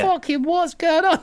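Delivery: very high, slow mock bewilderment; hammed-up disbelief, way up high; mock bafflement. Dad reacting to his daughter's accent, mock baffled. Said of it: "I'm like".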